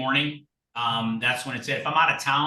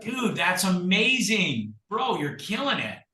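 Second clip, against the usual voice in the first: high-pitched, gushing praise, acted out; mock admiration; 6 semitones higher, slower than usual. Flattering a bragging agent, high mock admiration. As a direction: play the wowed fan, voice up high.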